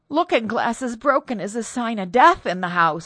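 high, loud fearful warning; superstitious maid in dread; fearful servant. Acted. Servant warns of omen.

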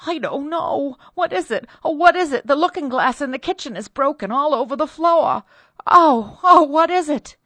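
high, loud, frantic cries; panicked maid. Acted. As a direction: near hysteria, begging to know. Cordelia staggers in, panicking.

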